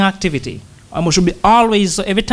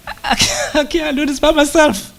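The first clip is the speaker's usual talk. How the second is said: higher, pleading voice of helplessness; higher earnest plea for help; 10 semitones higher than usual. Acted. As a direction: plead like a man who can't manage alone. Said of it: "pleading prayer after the psalm".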